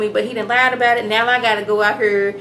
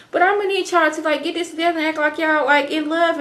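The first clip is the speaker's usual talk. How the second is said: higher, pushy mock Elizabeth; a hammed-up bossy boss in a higher voice; urgent insisting; 7 semitones higher, faster than usual. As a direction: press them hard to keep up the act. Elizabeth orders them to act.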